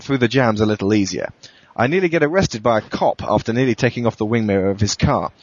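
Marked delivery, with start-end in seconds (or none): none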